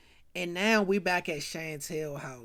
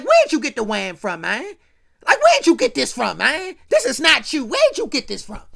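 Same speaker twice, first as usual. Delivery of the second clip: loud, higher demanding questions; angry interrogation; 4 semitones higher, 9 dB louder than usual. Retold or imitated. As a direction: press him hard for an answer. JC interrogates G angrily.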